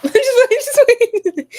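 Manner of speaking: high, loud words through laughter; laughing. Real, not acted. Streamer breaking up at her own teasing, laughing. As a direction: crack up while begging them to wait.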